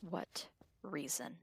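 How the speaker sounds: quiet, hurt. Acted. Hurt reply in argument.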